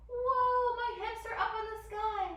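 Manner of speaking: high, loud mock wonder; hammed-up whoa, pitched high; playful amazement. Teacher showing children downward dog, high playful amazement.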